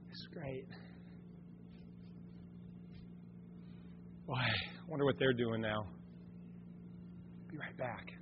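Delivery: very quiet, low musing to oneself; furtive aside. Acted. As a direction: say it under the breath, slipping away. Acting a convert drifting back.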